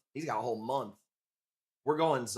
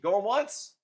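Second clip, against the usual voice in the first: higher, louder auctioneer cry; auctioneer call; 8 semitones higher, 6 dB louder than usual. Acted. Streamer auctioning a coral torch, auctioneer call.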